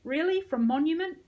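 high, loud questions; surprised. A narrator's reading. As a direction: ask in amazed disbelief. Medic surprised by the news.

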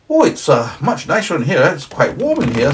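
high, loud cheery snowman. Acted. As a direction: play the indignant snowman, high and bright. Snowman voiced.

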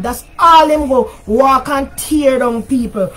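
ordinary delivery

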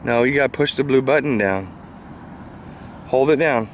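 ordinary talk

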